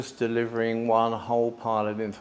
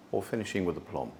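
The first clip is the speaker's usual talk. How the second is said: finishing with aplomb; 6 semitones lower, 4 dB quieter, faster than usual. Shown as a demonstration. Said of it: "what voice tone can you deliver".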